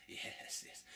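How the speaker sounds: ordinary talk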